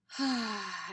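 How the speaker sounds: audible open-mouthed exhale; releasing sigh. Shown as a demonstration. Teacher showing listeners an exhale out of the mouth, releasing sigh. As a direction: let the breath go in a sigh. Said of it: "exhaling out of the mouth".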